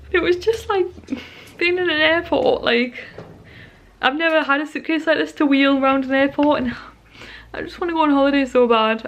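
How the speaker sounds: gush, a little overcome by it all; emotional longing. Real, not acted. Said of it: "I just got so emotional".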